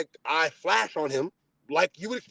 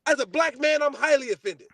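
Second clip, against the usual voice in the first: angry; 6 semitones higher than usual. Retold or imitated. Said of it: "I'm like".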